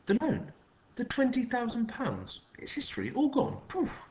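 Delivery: high, swooping sing-song; like a frantic merry-go-round; trilled. A narrator's reading. Neighbour gleefully announces the paid loan, high and trilling. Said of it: "trilled Patricia".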